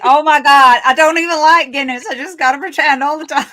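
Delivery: loud, high hammed-up Texan; exaggerated Texas accent, laughing at the end; mock Texas accent. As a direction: parody a Texan confessing at full volume. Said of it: "I've lost my Texas accent".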